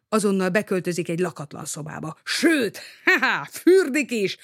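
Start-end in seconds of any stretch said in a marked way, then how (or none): 2.2-4.4 high, laughing delight; gleeful. A narrator's reading. Man planning a bath with his found key, gleeful. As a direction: crow happily with a little laugh.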